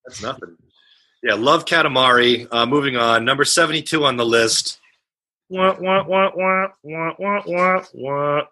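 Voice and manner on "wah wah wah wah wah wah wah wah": muffled trumpet-like wah wah beatbox; muffled wah wah talk. Shown as a demonstration. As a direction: hum a muffled wah wah riff. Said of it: "I'm gonna try to do my own beatbox".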